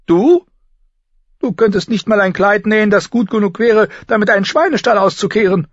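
put-on high, loud girl's scorn; full of contempt. Sansa mocks her sister's sewing, scornful. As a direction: sneer loudly at the very idea. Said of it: "Voller Verachtung warf Sansa den Kopf".

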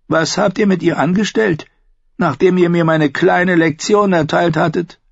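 Tyrion's voice. Acted.

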